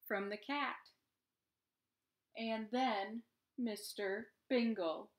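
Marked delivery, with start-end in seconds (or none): none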